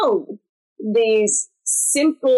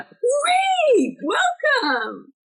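bright welcome; much slower than usual. Retold or imitated.

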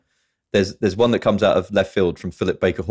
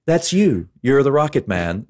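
mock movie-hero payoff, pitched up; Nicolas Cage; 6 semitones higher, slower than usual. Quoting the hero before he fires the rocket, high and punchy. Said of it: "And he, he goes".

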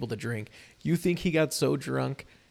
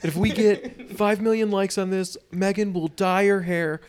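higher, louder, slowing on the big promise; hyped streamer; 6 semitones higher, 5 dB louder than usual. Retold or imitated. Royal couple as YouTubers begging for likes, hyped up. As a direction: pitch the like-goal like a livestreamer. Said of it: "you just see, like".

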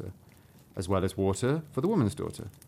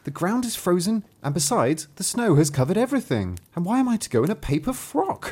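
put-on a touch of a girl, higher and pleading; upset girl; 8 semitones higher, 3 dB louder than usual. A narrator's reading. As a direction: protest lightly as the cold young girl. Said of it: "said the girl".

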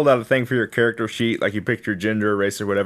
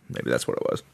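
whispering; 11 dB quieter than usual. Real, not acted. Muttered aside.

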